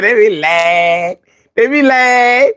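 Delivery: slow, stretched LYING climbing high; comic drawn-out insistence. Pretend. Joking that cougars be lying, exaggerated drawn-out cry. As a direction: drag out "lying" long, pitch rising on the last.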